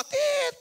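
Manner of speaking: high-pitched imitated bleep; censor beep. Preacher bleeping out a worldly person's swearing, high censor beep. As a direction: squeak out a sustained censor beep. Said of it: "Banyak sensornya".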